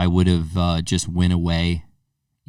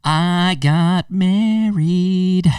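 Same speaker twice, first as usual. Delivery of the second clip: very high, drawn-out reveal; playful big announcement; 11 semitones higher, much slower than usual. Real, not acted. Host revealing his weekend news to cohost, drawn-out announcement. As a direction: stretch every word out high, almost singsong.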